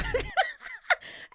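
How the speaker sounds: high shrieking laugh. Real, not acted. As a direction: burst out laughing. Laughing at the scene.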